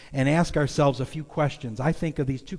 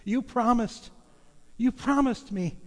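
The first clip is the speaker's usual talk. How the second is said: put-on high, earnest child's voice; little boy voice; 9 semitones higher than usual. Trapped son telling his father he kept faith, high child voice. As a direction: speak as a trusting little boy. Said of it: "and his son says".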